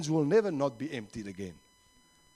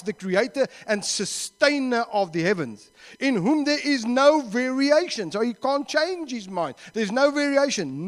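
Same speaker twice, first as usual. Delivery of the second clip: loud, raised preaching delivery; emphatic scripture reading; 6 semitones higher, 4 dB louder than usual. Acted. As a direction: proclaim the verse with force. Reads scripture aloud while preaching.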